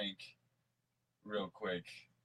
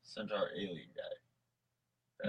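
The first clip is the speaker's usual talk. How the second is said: slow, unvoiced hush; mock whisper; 3 semitones lower, 7 dB quieter, much slower than usual. Host whispering about the alien centaur. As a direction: whisper it like a secret reveal.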